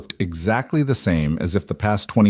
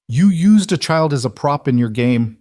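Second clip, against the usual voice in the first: higher-pitched, indignant accusation; shaking with fury; 6 semitones higher, 4 dB louder than usual. A narrator's reading. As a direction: accuse with restrained narrated fury. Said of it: "voice shaking with fury".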